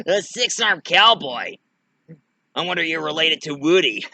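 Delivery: cackling villain doll's mockery; jeering doll. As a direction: taunt with amused scorn. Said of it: "He's going like".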